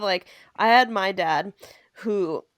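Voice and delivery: ordinary delivery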